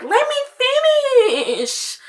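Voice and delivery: very high, drawn-out bratty whine. Retold or imitated. Bratty friend refusing to hand the phone back, high drawn-out whine. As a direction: stretch "finish" out high like an annoying brat. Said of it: "'Cause I'm annoying and I'm a brat".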